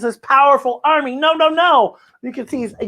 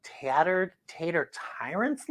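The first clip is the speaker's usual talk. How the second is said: puzzled; 3 semitones lower, 11 dB quieter than usual. Acted. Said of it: "Lucy asks".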